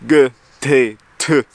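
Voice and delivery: "G, T, T" each said crisply as separate hard sounds; hard consonants. Shown as a demonstration. German speaker demonstrating the hard letters, crisp "G" and "T". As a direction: tap out the letters "G", "T", "T" sharply one by one. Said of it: "hard characters like K".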